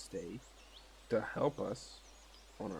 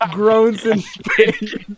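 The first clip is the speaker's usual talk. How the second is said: hammed-up groan; 11 semitones higher, 8 dB louder, much slower than usual. Player hams up a pained reaction.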